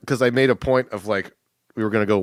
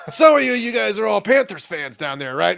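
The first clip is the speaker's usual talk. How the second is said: mock shout; 9 semitones higher than usual. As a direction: call it out like an eager fan. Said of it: "He's like".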